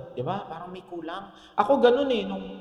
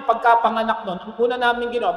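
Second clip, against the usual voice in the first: ordinary talk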